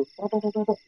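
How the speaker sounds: quick, repeated "tuk" knocks; imitated knocking. Storyteller mimics stones hitting a motorbike, imitated knocking. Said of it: "suaranya kayak gitu".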